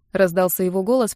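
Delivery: ordinary delivery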